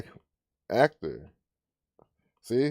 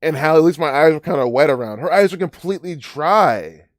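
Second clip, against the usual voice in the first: louder, higher, worked-up disbelief; incredulous rant; 7 semitones higher, 8 dB louder than usual. Real, not acted. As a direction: rant at the fake tears, voice up and loud. Ranting that reporter fakes tears.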